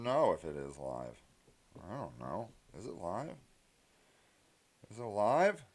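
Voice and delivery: very soft, low mutter to oneself; muttered checking. Acted. Streamer checking whether the stream is live, muttering low.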